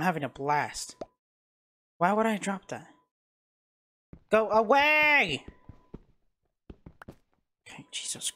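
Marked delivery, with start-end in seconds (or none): 4.3-5.4 slow, higher and louder, every vowel stretched; drawn-out yell. Real, not acted. Stuck again, mob near. 7.7-8.3 breathless whisper under his breath. Real, not acted. Hiding from mob, hushed.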